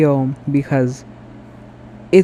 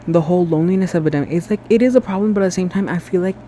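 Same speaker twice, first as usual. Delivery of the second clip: ordinary delivery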